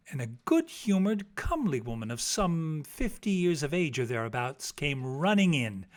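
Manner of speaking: ordinary delivery, nothing marked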